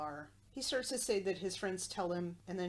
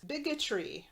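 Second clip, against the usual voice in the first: a light mock Italian accent, pitched higher; Chico Marx; 8 semitones higher, 4 dB louder than usual. Mork exclaiming to Mindy in a light Chico Marx Italian accent. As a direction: punch it out like a vaudeville Italian. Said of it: "in sort of a Chico Marx kind of way".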